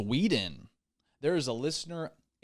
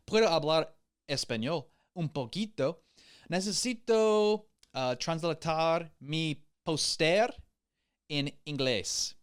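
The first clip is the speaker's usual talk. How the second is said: bad Spanish accent; 5 semitones higher than usual. Host asking Spanish speakers for help, in a bad Spanish accent. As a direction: try out clumsy classroom Spanish with a heavy American accent. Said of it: "It's a pretty bad accent".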